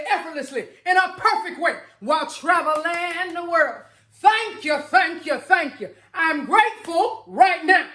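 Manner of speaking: rising to an ecstatic, tearful chant of thanks; fervent affirmation. Shown as a demonstration. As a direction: pour everything into it like it already happened. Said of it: "I'm gonna say this one more time for you".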